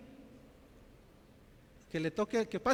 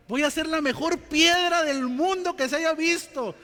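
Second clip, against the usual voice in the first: fervent proclaiming; 8 semitones higher, 8 dB louder than usual. Real, not acted. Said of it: "preacher's emphatic vow".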